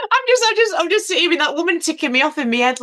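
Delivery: giggling through the words, high; laughing helplessly while speaking; nervous laugh. Real, not acted. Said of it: "I'll nervous laugh".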